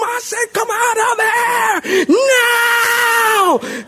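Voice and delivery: high-pitched scream, last word hugely drawn out; hammed-up exorcist shrieking at a demon; mock screaming exorcist. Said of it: "people were yelling and screaming and spitting".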